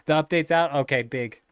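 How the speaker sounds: usual speaking voice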